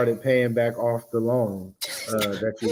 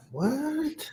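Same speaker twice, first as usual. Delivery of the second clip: shocked; 8 dB quieter than usual. Real, not acted. Shocked at the interest total.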